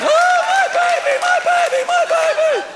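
very high falsetto wailing; hammed-up mother shrieking over her child; hysterical mom. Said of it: "here comes Jimmy's mom out of the stands".

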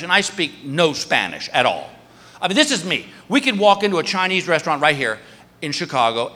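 ordinary talk, nothing marked

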